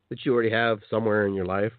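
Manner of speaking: normal talking voice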